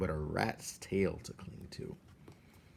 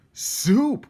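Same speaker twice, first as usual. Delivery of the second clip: high, loud gleeful call; 13 dB louder than usual. Acted. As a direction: shout it out hungrily. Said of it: "called out another rat".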